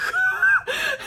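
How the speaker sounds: high, loud laughing burst; real laugh; burst of laughter. Real, not acted. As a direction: crack up loudly. Laughs at own joke about book.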